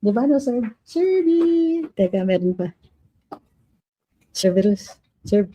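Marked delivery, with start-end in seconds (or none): 0.8-1.9 high fond pet call; calling the dog. Real, not acted. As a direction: squeal the dog's nickname.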